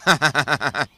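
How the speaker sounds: arrogant laugh. Acted. घमंडी बैल शेर पर हंसता.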